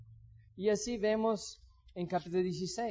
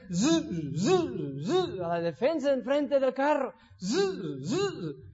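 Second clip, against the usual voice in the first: sawing noise; 4 semitones higher, slower than usual. Acted. Rabbi saws the car bumper.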